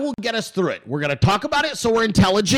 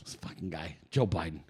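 much lower, much softer grumble; low muttered aside; 12 semitones lower, 13 dB quieter than usual. Real, not acted. Host grumbling about Joe Biden, low muttered aside. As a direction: mutter it under your breath.

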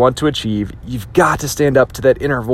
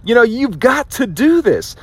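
slow, high, insistent; self pep talk; 9 semitones higher than usual. Shown as a demonstration. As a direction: push yourself forward, slowly. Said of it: "stand up inside yourself sometimes and say".

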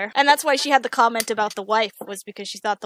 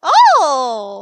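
loud startled oh at the roll; surprised shout; 3 semitones higher, 11 dB louder than usual. Real, not acted. GM reacting to a great roll, surprised shout. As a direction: gasp it out, loud and amazed.

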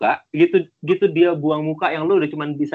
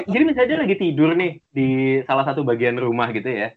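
normal talking voice

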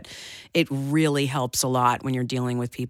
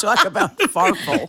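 said through a laugh, slow; 11 semitones higher, 5 dB louder than usual. Real, not acted. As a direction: giggle out the punchline. Laughing at own joke.

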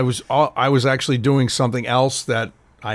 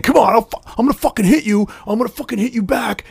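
mock yelling threat; 8 semitones higher than usual. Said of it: "yelling at each other".

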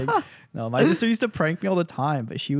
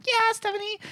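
put-on eager kid. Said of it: "so I'm like".

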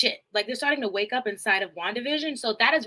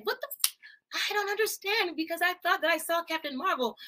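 high-pitched, startled disbelief; confused surprise; 6 semitones higher, 5 dB quieter than usual. Real, not acted. Streamer reacting high and baffled to Captain Marvel news. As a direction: blurt it out high, thrown by the news.

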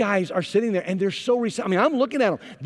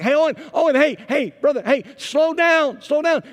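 higher, louder call across the room; calling out urgently; 10 semitones higher, 5 dB louder than usual. Retold or imitated. Pastor urgently calling to the coach mid-clinic, higher and louder. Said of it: "I'm trying to get Owen's attention".

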